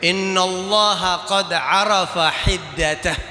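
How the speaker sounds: slow, weighty Arabic line; underlined quote. Shown as a demonstration. Preacher underlines Jibril's Arabic answer, slow and weighty. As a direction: lay each word down slowly. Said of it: "ente garis bawahi".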